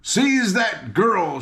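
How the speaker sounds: high, slow command; commanding shout. A narrator's reading. King shouting an order to his captain, commanding. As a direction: order the guards to grab her. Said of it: "shouted the king to his captain".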